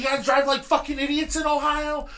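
imitating a furious state trooper; trooper yelling. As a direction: bark it like an angry cop chewing someone out. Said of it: "he let off again with the".

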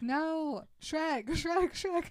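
high, loud mock cries for help; retold frantic yelling; panicked calling. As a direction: call out in a hammed-up panic. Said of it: "he won't stop screaming".

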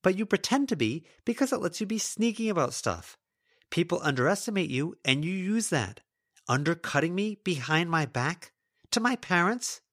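high, heated accusing rant; angry tirade. Acted. Lila rants at Melody.

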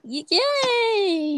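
high, hesitant, stretched-out cheer; mock-enthusiastic high squeak; awkward fake cheer. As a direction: feebly celebrate when you don't know what to say. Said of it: "I was like".

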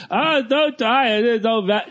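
put-on whiny kid. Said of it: "my twelve-year-old".

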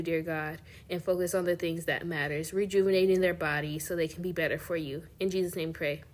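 soft, earnest prayer voice. Acted. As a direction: pray gently over the listeners. Leads a prayer.

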